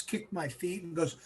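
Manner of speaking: ordinary delivery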